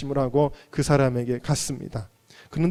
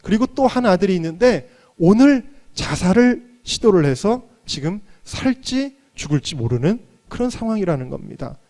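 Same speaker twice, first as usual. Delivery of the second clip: ordinary talk, nothing marked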